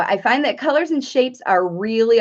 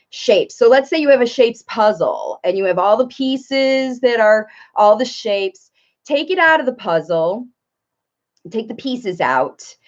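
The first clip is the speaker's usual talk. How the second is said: ordinary talk, nothing marked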